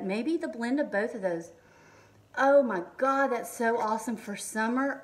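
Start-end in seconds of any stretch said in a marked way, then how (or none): 2.4-3.3 loud, high burst of delight; thrilled by the smell; oh my God, real delight; excited gasp. Real, not acted. Sniffing new tropical body butter.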